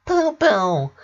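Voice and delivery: put-on high baby voice; baby babble. A narrator's reading. Baby reaching for her brother, high babble. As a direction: babble like a toddler.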